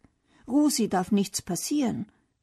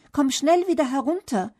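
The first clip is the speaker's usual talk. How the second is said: higher-pitched urgent call; 6 semitones higher, 4 dB louder than usual. A narrator's reading. Bernd urges Felix down from the tree, calling out. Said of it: "ruft Bernd".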